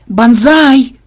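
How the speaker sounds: loud, high shout; yell. A narrator's reading. Programmer urgently calling his captain over, loud yell. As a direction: bellow the name, high and drawn out. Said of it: "Чего орёшь?".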